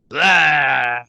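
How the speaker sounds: loud cartoonish blah; cartoonish monster roar. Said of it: "Very, very sort of cartoonishly going".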